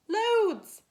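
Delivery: high, loud cheeky sibling shout. Acted. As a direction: shout it like a gang of kids. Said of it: "said her brothers and sisters".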